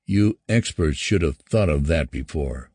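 usual speaking voice

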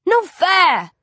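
high, loud petulant shout. Acted. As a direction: yell it like a sulky kid who lost. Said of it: "Essex shouts".

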